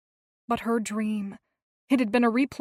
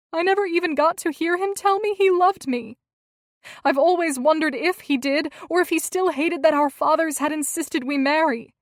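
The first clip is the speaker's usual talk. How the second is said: tearful; 6 semitones higher, 8 dB louder than usual. A narrator's reading. Tearful confession of grief.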